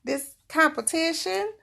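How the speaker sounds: mock exasperated self-quote; baffled at own tears. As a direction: retell her own puzzled outburst, rising to a squeak. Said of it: "I'm like".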